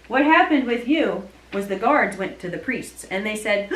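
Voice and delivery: normal talking voice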